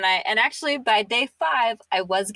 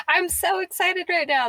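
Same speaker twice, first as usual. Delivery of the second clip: higher, gushing mock excitement; imitating excitement; 10 semitones higher than usual. Guest quotes host's go-to line, mock excited. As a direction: echo her excited line back playfully. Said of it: "your go-to response is".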